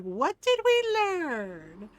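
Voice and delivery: slow, high singsong question; mock lecturing. Teasing kid caught by mom, slow singsong lecturing. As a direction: stretch it out teasingly, swooping up on learn.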